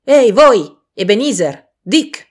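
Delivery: loud, high, rich hail; big, oily, jolly shout; jovial call. A narrator's reading. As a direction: call the lads over heartily. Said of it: "chiamò con una voce piacevole, oleosa, ricca, grassa e gioviale".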